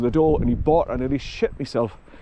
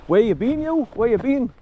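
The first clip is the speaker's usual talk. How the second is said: higher sing-song voice for the dog; doggy talk; 8 semitones higher than usual. Real, not acted. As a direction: coo at the dog, pitched well up. Talking fondly to returning dog.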